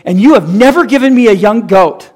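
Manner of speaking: loud, high self-pitying whine; milk the injustice; pity party whine. Retold or imitated. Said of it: "pity party just ratchets".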